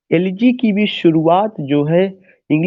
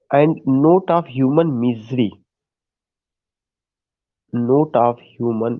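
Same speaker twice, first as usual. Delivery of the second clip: measured note-taking pace; slow dictation; 4 semitones lower, much slower than usual. Real, not acted. Teacher dictating notes on human misery, slow dictation. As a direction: dictate slowly, word by word.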